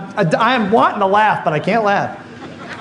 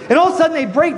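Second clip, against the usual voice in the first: usual speaking voice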